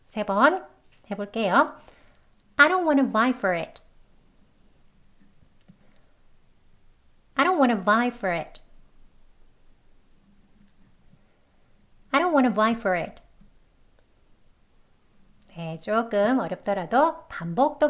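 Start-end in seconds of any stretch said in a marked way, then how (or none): 2.6-13.2 "don't wanna" as "ron-wanna", FOR running into IT; slow connected model sentence; reduced and linked. Shown as a demonstration. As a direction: run "I don't wanna vie for it" together. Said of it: "이어서 해볼게요".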